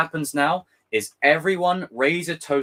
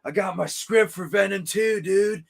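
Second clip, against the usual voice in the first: higher, louder surfer bro; laid-back dude voice pitched up; 9 semitones higher, 4 dB louder, much slower than usual. Retold or imitated. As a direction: play the goofy surfer writing scripts. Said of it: "that surfer dude from the trailer going".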